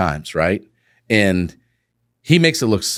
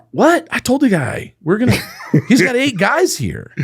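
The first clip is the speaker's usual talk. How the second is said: incredulous. Retold or imitated. Shocked at partner refusing job.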